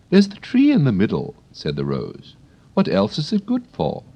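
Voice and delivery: high, prim flower voice; a proper lady of the garden; prim Rose. Acted. The Rose chats to Alice, high and prim.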